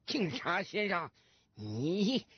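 put-on drunk man. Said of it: "也没客气".

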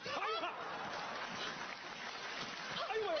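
high-pitched, theatrical yelp; shocked outcry. Acted. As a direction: cry out aghast, voice shooting up. Shock at the house being sold.

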